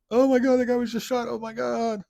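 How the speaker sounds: high, hammed-up fake panic; mock the fake bystander's overacting. Mocking a staged bystander's fake panic. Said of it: "Guy acting like he's running to help".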